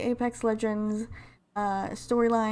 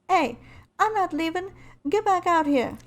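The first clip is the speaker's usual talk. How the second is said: calling out in a raised, higher character voice; Lifeline impression; 8 semitones higher, 3 dB louder than usual. Lifeline calling after Bangalore at the slammed door, high and insistent. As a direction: demand it through the door, pitched well up. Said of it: "trying to do my best Lifeline impression".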